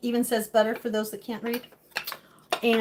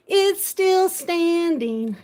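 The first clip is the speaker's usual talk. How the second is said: slow, louder, delighted; drawn-out happy repeat; excited; 5 semitones higher, 5 dB louder, much slower than usual. Shown as a demonstration. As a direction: stretch it out in joy.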